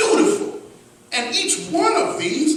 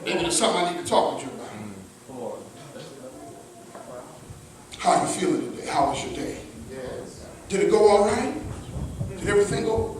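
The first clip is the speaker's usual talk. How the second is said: soft, lower caring questions to a partner; tender concern; 7 semitones lower, 9 dB quieter, much faster than usual. Shown as a demonstration. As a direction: ask gently like you truly care. Husband tenderly asking wife.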